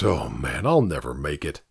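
low, soft, defeated groan; weary groan. Acted. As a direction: sigh it out, worn down. Santa groaning about remaining hours.

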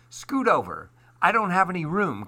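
high, hurried grumble; complaining. A narrator's reading. Duck squeezed against Goose on the egg, complaining. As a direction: whine about being squashed. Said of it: "complained Duck".

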